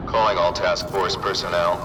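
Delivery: low, brisk official radio broadcast; radio dispatch. Acted. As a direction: issue orders rapidly over the radio, low-pitched. Task force broadcast over radio.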